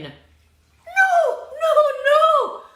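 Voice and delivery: high squeaky piggy voice; squealing little pig. Acted. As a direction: squeak it out like a scared piglet. Pig refuses the wolf.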